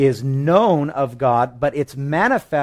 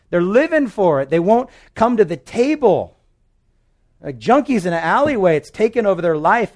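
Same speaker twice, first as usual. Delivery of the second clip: God voiced higher, earnest and pleading; a father pleading for his lost kids, higher; 8 semitones higher, 4 dB louder than usual. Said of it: "And God's like".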